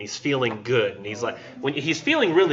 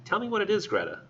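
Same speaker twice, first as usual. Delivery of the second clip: softly, pitched up then falling away; soft coaxing; 8 semitones higher, 6 dB quieter, faster than usual. A narrator's reading. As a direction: gently press for an answer. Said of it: "he said again softly".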